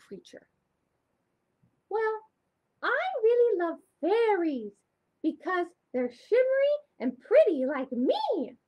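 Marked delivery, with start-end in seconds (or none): 1.9-8.5 high, loud, preening puppet voice; a vain little puppet showing off; puppet Shimmer. Acted. Shimmer the puppet boasting she is pretty like fairies, high and loud.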